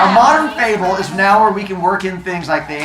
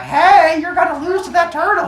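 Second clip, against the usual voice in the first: squirrel cheerleader voice; 12 semitones higher than usual. Acted. Squirrel cheerleader warns rabbit.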